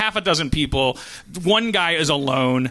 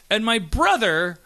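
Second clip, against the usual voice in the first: normal talking voice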